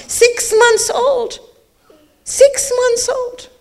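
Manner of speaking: high, slow repeated lament; grieving. Acted. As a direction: let the grief rise in the repeat. Repeats the baby's age in grief.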